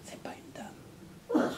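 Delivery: hushed aside to the audience; conspiratorial whisper. A narrator's reading. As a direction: whisper it like a secret. Confiding a secret to listeners.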